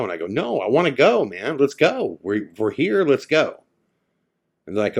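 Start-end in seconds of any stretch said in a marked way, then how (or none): 0.3-3.6 higher, louder retold eagerness; insistent urging. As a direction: push the group to get moving. Retelling his urging the group on.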